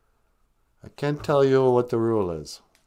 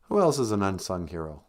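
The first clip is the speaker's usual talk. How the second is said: ordinary talk, nothing marked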